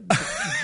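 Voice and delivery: a sudden high squealing laugh; high squeal of laughter. Real, not acted. Laughing while telling his co-host the gross part. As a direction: burst into a shrieking giggle.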